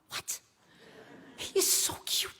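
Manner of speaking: hushed, breathy aside so he can't hear; urgent whisper. Retold or imitated. Pleading with her friend in secret, whispered. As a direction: whisper it behind your hand.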